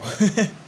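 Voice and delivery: short high burst of laughter; real laugh. Real, not acted. Vlogger laughing at her own question, a real burst of laughter. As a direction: laugh out at your own joke.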